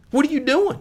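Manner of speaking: high, outraged yelp imitating a friend; mock outburst. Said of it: "He goes nuts on me".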